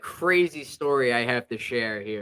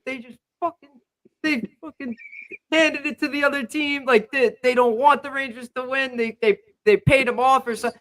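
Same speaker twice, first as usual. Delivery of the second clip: high, furious rant at the refs; friend flipping out; 10 semitones higher, 4 dB louder than usual. Retold or imitated. Imitating a friend yelling at the refs after the period, high-pitched fury. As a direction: imitate a friend raging at the referees. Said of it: "he was flipping out on the refs".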